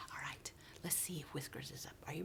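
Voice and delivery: put-on fast hushed whisper to the kids; conspiratorial whisper. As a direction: whisper like you don't want to wake him. Teacher hushes kids before calling.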